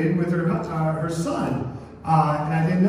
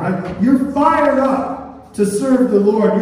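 louder, higher, slowed preaching; fervent preaching; 4 semitones higher, 6 dB louder than usual. Acted. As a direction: preach it fired up, boiling over. Preaching on boiling for God.

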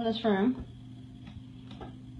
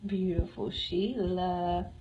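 tender; 6 semitones lower, 8 dB quieter, much slower than usual. Real, not acted. Admiring the cat.

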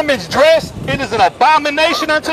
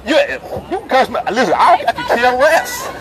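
laughing it off; 3 dB quieter than usual. Real, not acted. Shrugging off hecklers' insults.

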